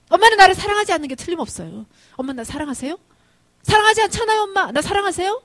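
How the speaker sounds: loud, high, anguished pleading; tearful protest. Retold or imitated. Son asking mother why she hates him, tearful protest. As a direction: cry out in hurt, reproachful bursts. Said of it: "닭똥 같은 눈물을 두둑두둑 흘리면서".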